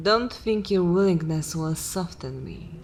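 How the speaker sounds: normal talking voice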